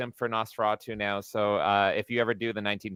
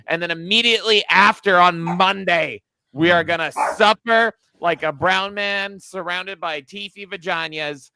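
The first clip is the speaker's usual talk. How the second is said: excited promo; 6 semitones higher, 7 dB louder than usual. Real, not acted. Promoting the next stream.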